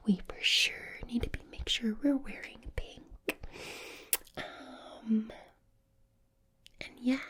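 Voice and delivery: put-on whispered Valley Girl accent. Shown as a demonstration. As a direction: whisper like a Valley Girl coaxing someone to sleep. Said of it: "a Valley Girl accent".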